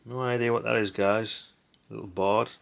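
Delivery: ordinary talk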